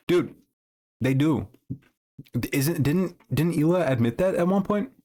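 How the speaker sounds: talking while chewing; words round a bite of food; mouth full. Real, not acted. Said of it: "I'm so sorry for eating with my mouth full".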